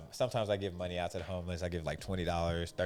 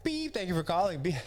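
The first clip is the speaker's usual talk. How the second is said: call center greeting; 10 semitones higher, 4 dB louder than usual. Retold or imitated.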